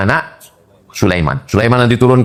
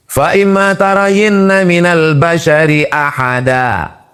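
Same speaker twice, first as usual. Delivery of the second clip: slow, higher chanted Quran verse; Quran recitation; 8 semitones higher, slower than usual. Acted. (tilawah Quran yang lambat dan bernada)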